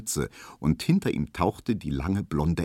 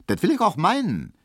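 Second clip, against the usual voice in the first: kurz angebunden; 12 semitones higher, 4 dB louder than usual. A narrator's reading. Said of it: "gab Hedwig kurz zurück".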